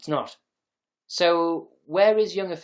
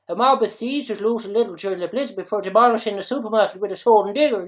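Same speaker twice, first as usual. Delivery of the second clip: put-on loud, higher Dublin accent read; 5 semitones higher, 8 dB louder than usual. Streamer reading Lidl looting report, loud mock Dublin accent. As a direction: belt out the story as an excited Dubliner. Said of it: "put Dublin accent into".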